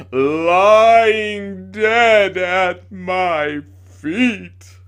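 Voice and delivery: loud, very slow hammed-up tragedian; theatrical lament. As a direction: drag it out like a ham actor. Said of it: "in a choking voice".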